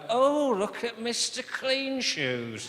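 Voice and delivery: drawn-out mock jeer from drunk passengers. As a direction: sneer at the sober guy. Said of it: "looking at me going".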